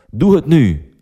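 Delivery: woman on the phone ordering David; commanding. Acted. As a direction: snap out a command. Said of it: "beveelt de vrouw".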